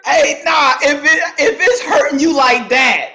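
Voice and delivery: mock crying shout. Continues mock crying protest.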